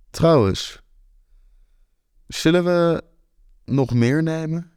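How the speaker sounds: drawn-out, doubtful ask; slow and hesitant. Acted. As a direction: feel for each word, unsure, with long gaps. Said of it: "Breng ik langzaam en twijfelend uit".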